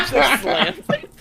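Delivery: high loud laughing. Real, not acted. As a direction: laugh through the words. Laughing while describing slam.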